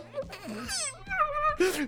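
squealing giggle you can't hold in; a high, stifled fit of giggles; uncontrollable high giggle. Retold or imitated. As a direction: giggle helplessly, high and breathy. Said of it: "I just started giggling".

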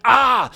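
loud, high burst of frustration; sudden "ah!" of exasperation; exasperated yell. Acted. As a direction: let out a pent-up yell. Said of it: "it's just expressing, like".

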